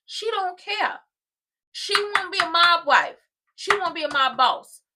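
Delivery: loud exasperated proclamation; exasperated outburst. Real, not acted. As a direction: throw up your hands and belt it. Exasperated rant about her choice.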